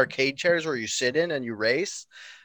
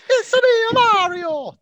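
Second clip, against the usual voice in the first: much higher, slow, cartoon Italian accent; bouncy Italian-accented video game catchphrase; Mario impression; 5 dB louder, slower than usual. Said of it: "That's how he says it in the video game".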